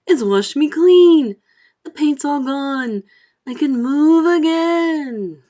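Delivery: slow, high, loud delight; joyful centipede. Acted. Centipede rejoicing to the crew, high joyful voice. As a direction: savour each freedom gleefully.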